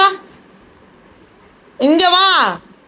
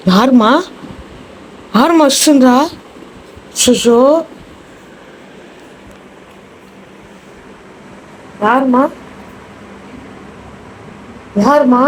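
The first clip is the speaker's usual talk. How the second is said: calling out. Real, not acted. Calling out to someone in house.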